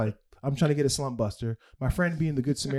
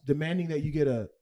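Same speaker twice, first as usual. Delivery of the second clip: usual speaking voice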